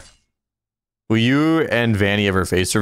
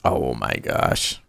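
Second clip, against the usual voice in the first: drawn-out sigh; 9 semitones lower, 5 dB quieter, much slower than usual. Real, not acted. Slow low exclamation.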